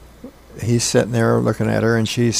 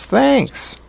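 put-on kid's thanks; 14 semitones higher than usual. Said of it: "Hammy goes".

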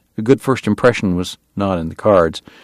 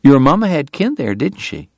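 Southern lady; 5 semitones higher than usual. A narrator's reading. Southern wife questions her guest.

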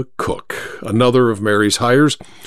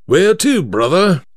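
higher-pitched London driver, suddenly obliging; won-over cabbie; 5 semitones higher than usual. Acted. Third driver takes the bribe, obliging. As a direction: ask for the destination in a cabbie's voice.

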